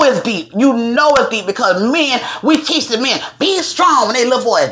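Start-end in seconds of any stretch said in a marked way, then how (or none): 3.4-4.1 higher-pitched parental order; lightly acted parent toughening up a child; mock stern parent. Parent teaching little boys to be tough, mock stern.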